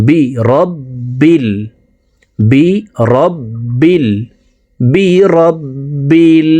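বিরব্বিল very slow with doubled b; slow drilled repetition. Shown as a demonstration. Teacher drilling students on the word. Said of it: "রব্বিল বলতে হবে".

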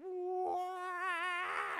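a high, loud whining wah like mic feedback; feedback wail imitation. Worship pastor imitating sound system feedback for the congregation, high loud wail. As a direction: mimic a speaker squealing with feedback. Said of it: "the sound system just starts going".

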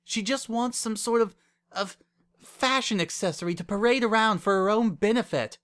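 high, emphatic, exasperated argument; heated pleading. A narrator's reading. Wendy warning Lyra about her lover, heated. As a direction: build a worked-up case against her.

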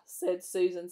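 ordinary delivery

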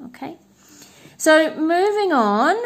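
normal talking voice

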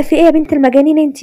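jolted out of sleep, mildly alarmed; startled awake. A narrator's reading. Brother woken by his sister's prank, startled. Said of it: "قادر قام مفزوع".

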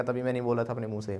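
ordinary delivery

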